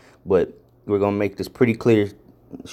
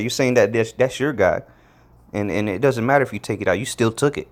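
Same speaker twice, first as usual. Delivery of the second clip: ordinary talk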